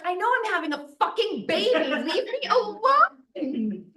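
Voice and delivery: high, laughing snap, slowing on leave me alone; mock-furious mother in labour; exasperated retort. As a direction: toss it back at the midwife, half laughing. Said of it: "and I was like".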